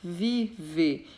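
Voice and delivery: "viver" in two separate beats: vi, ver; teacher dividing "viver" into its two syllables; split into syllables. Shown as a demonstration. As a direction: say "viver" with a gap after "vi". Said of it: "todas essas palavras são dissílabas".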